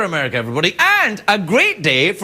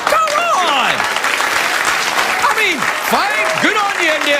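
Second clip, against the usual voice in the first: high exasperated come on; mock outrage; 9 semitones higher than usual. Real, not acted. Host protesting outsourcing, high mock outrage.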